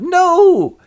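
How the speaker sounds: loud, high wailed no; horrified cry. Acted. Orphan told he'll be sold, wailing loudly. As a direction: cry out in melodramatic protest.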